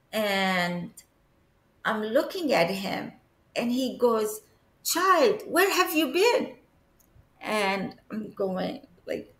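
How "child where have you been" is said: high-pitched scolding question. Retold or imitated. Late Pope questioning the child on seeing her, scolding. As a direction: chide a child who has been missing. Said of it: "like, scolding me?".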